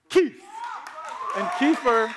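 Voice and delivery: high, loud cheer of a name; sudden high-pitched holler; calling out. Real, not acted. Cheering the kid he named.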